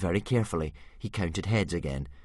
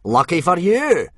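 ominous; 9 semitones higher, 11 dB louder than usual. A narrator's reading. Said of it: "said Gobber ominously".

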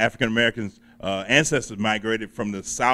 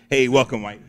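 usual speaking voice

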